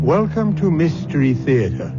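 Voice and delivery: normal talking voice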